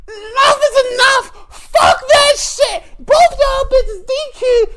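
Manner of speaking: very high, loud, crying shout; furious high shrieking ref; referee yell. Retold or imitated. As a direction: scream it like a fed-up referee. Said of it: "She was like".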